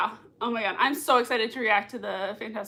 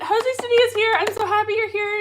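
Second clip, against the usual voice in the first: high, loud delighted welcome; gushing, pitched way up; excited greeting; 9 semitones higher, 6 dB louder, faster than usual. Real, not acted. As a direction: announce a friend's arrival with glee. Spots a viewer arriving.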